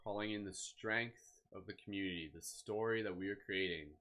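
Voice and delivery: calm, unhurried meditation voice; guided meditation. Acted. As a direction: ease listeners gently into stillness.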